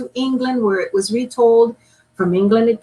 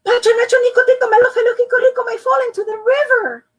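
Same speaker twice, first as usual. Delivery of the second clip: high, loud, fast rattle of names; boy gets the whole name out for Mama; breathless rush; 5 dB louder, much faster than usual. Acted. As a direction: race through the long name in one breath. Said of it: "Juan took a deep breath".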